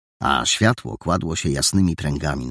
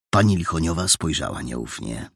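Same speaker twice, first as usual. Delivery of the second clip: ordinary delivery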